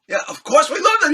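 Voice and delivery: high, loud, excited answer; imitated over-eager enthusiasm; gushing fan. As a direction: gush like a starstruck fan. Thrilled fans answer the star.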